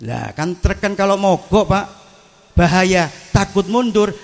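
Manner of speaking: ordinary talk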